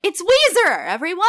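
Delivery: loud, high-pitched hype shout; mock announcement. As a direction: announce the band like a hammed-up emcee. Joke announcement of a band.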